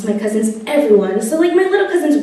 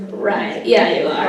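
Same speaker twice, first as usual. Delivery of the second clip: sarcastic uncle; 6 semitones lower than usual. Retold or imitated. Uncle sarcastically doubting the friend.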